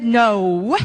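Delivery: ordinary delivery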